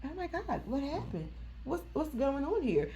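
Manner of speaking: soft worried imitation of the sister; hushed concern. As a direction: mimic the sister's hushed shock. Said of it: "she was like".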